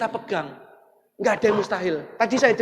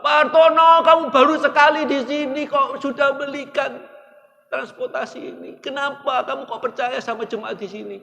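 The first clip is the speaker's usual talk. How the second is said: high, loud tearful pleading; weeping pastor's wife; 8 semitones higher, 5 dB louder than usual. Retold or imitated. Preacher voicing the pastor's wife, high and tearful. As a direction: voice her crying in disbelief.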